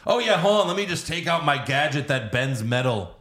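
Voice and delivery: breezy sarcastic gadget boast; mock casual Batman. Mocking Batman's handy gadget, breezy, high then slowing with laughter.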